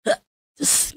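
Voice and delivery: very high, soft breathy "Ugh, ces"; hammed-up teary squeal; mock sob squeak. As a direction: whimper in a tiny squeak at a sad film. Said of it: "nonton yang sedih".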